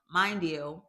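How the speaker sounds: usual speaking voice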